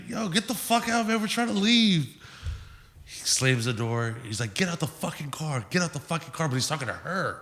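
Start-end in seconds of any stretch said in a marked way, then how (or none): 0.1-2.1 put-on irate girl. Girl orders the man out of her car, high-pitched mock yell. 4.5-6.5 raised pitch, softened imitation of yelling; mock yell. Man orders girl out of the car, hushed mock yell.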